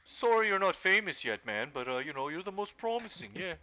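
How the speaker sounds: high, patronizing mock presenter; condescending award voice. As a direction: talk down like a smug judge. Voicing condescending award giver.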